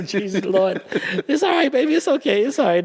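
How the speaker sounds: high, laughing through the comfort; laughing reassurance. Retold or imitated. Photographer consoling the late assistant, laughing. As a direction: chuckle while soothing a scared kid.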